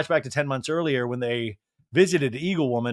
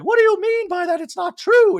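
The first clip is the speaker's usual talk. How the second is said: much higher, louder indignant mock Dorit; 6 dB louder than usual. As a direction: squeak out the objection as a caricature. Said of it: "And Dorit's like".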